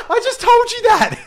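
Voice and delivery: high, loud, laughing protest; exasperated. Retold or imitated. Host mocking his forgetful co-host, loud laughing exasperation. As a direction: throw up your hands, laughing, I just said that. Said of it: "I'm like".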